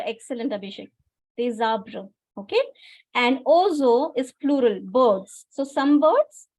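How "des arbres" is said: des runs into arbres as "dez"; liaison in des arbres. Shown as a demonstration. Teacher repeats the right answer, des arbres linked. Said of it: "You have to make a liaison here".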